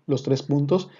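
usual speaking voice

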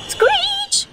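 high squealing screech of brakes. A narrator's reading. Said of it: "Goes the brakes".

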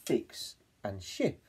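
fix and ship said slowly; drawn out recap. Shown as a demonstration. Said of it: "we began with two words".

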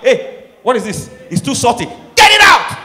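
rising to a high loud yell; mock angry husband. Husband rejecting salty food from wife, mock angry yell. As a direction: bark orders about salty food.

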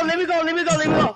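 frantic pleading. Real, not acted. Caught by killer, pleading.

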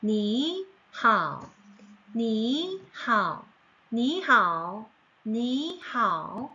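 니하오 with 니 rising in second tone, slow; Mandarin tone sandhi. Shown as a demonstration. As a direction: say 니하오 slowly, 니 rising, 하오 dipping. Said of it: "이성으로 바뀐다".

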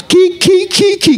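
high cackling kee kee; mock giggle. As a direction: imitate a silly high-pitched giggle. Mocking giggle of street folks.

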